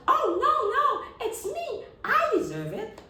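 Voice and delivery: high, loud, laughing claim; shrill eager grab for the prize; squabbling child. Acted. One son arguing he deserves the light, high and loud.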